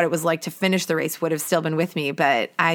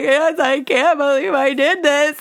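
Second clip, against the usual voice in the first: much higher, thrilled squeal; elated disbelief; 9 semitones higher, 3 dB louder than usual. Retold or imitated. Runner answers helpers after the finish, higher and elated. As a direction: gush with joy. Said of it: "And I was like".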